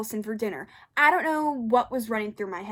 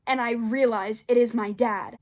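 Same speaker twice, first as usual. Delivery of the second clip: usual speaking voice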